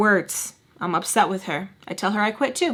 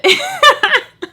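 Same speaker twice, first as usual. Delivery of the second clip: high, loud burst of laughter; real laughter; 5 dB louder than usual. Real, not acted. Storyteller amused by hand in toilet, high bursting laugh. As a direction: crack up helplessly mid-story.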